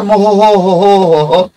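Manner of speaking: মোহ হো হো হো হো হো chanted like a laugh; mock reading. Shown as a demonstration. Teacher ridiculing a wrong abbreviation, chanted ho ho. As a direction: sound out the wrong spelling as ho ho ho. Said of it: "ভুলের আবার ভুল".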